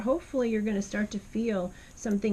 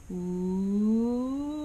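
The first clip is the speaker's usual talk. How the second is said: ooh sliding up and back down in the speaking range; vocal arch slide; 2 semitones higher, 6 dB louder than usual. Shown as a demonstration. Teacher demonstrates an arch on the card, gliding ooh. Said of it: "I'm gonna start with my speaking voice area".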